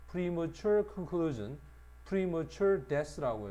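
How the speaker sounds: 아주 느리게 한 단어씩 짚어 읽기; 학생들 따라 하도록 느리게 시범; 천천히 또박또박. Shown as a demonstration. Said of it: "발음 시연". (느린 시범 읽기)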